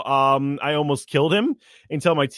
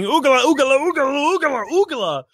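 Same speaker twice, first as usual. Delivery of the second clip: screaming; 11 semitones higher than usual. Acted. Pinning the enemy to the ground, high screaming chant. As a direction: scream it over the pinned enemy. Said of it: "still screaming".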